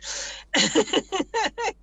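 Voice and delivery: high-pitched giggle. Real, not acted. Jokey threat to co-host.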